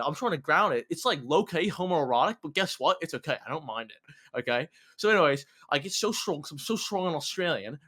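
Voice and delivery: hammed-up Aussie outback yarn; put-on Aussie bushman storyteller accent; Australian accent. Shown as a demonstration. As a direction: carry the tall tale in broad Australian. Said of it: "I could do a pretty good Australian accent".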